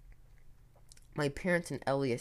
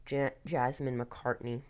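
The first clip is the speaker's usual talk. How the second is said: ordinary delivery, nothing marked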